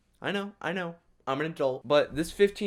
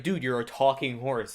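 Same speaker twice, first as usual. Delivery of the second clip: ordinary talk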